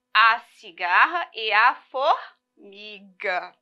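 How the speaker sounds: slowly, each word spelled out; slow title. Shown as a demonstration. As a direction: read the title slowly and clearly. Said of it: "Nós já sabemos que o nome dela é".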